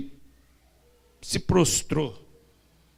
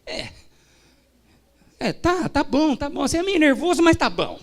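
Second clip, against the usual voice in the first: much higher, reluctant voice; grudging, hedging wife; hesitant wife; 13 semitones higher, 5 dB louder than usual. Retold or imitated. Wife answering her pastor husband, high and hesitant. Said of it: "a esposa dele olhou e falou".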